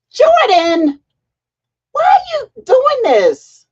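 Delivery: high, loud, slow mock-outraged cry; real playful outburst; excited shout. Real, not acted. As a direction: squeal at a teasing viewer. Viewer's comment teases her.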